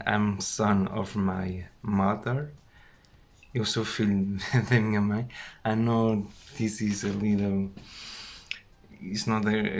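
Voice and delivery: ordinary delivery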